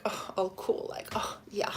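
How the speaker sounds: whispered cocky aside; smug whisper. Retold or imitated. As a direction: breathe it out smugly, unvoiced. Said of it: "he's like".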